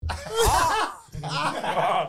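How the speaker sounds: high laughing ah ah; mock pained yelp. Retold yelp at injection.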